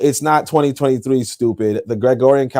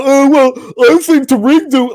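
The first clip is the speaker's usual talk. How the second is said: higher, prissy mock of his critics; lightly voiced nitpicker, pitched up; a mock of an armchair commentator, higher; 9 semitones higher than usual. Mocking people who criticize him.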